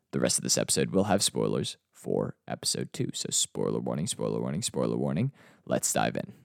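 low, hushed, playfully ominous; mock ominous warning.

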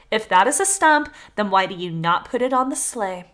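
ordinary delivery

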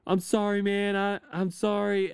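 high, loud panicky apology, imitated; teenager near tears. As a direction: imitate a flustered kid apologizing. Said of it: "like, about to cry".

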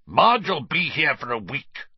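snarling. A narrator's reading. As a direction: snarl it at the boy. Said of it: "Uncle Vernon snarled".

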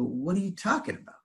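high, soft, startled question; retold disbelief. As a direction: react as if you can't believe what you heard.

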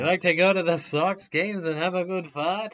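loud, high teasing Boston voice; Boston accent. Pretend. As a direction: rib him like a Sox fan. Teasing Boston sports fan.

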